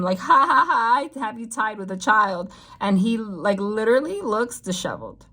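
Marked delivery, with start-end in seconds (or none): 0.2-2.5 mocking laugh. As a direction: gloat over him with a mock laugh. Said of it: "she's laughing at him".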